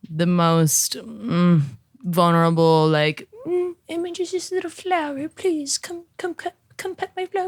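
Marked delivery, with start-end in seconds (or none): 3.5-7.5 high, tiny pleading flower voice; pleading little flower. Pretend. Vulnerable speaker begs as a flower, pleading little voice. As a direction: beg in a high, small voice, a little softly.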